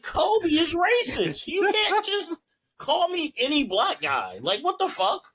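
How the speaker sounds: high-pitched, drawn-out comic indignation; mock outrage. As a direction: protest incredulously, hamming up the offence. Retells his outraged reply to her.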